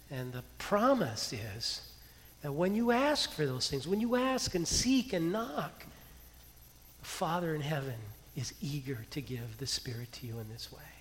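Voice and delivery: usual speaking voice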